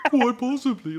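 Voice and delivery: hammed-up lame whine. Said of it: "so lame the way he says it".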